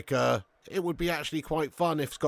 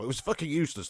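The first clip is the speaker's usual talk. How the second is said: ordinary talk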